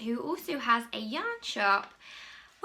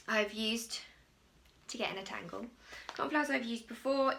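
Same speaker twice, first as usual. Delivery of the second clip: ordinary delivery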